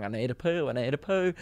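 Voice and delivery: hushed mock plea of someone needing the loo; desperate poo whimper. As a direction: squirm in line, quietly desperate.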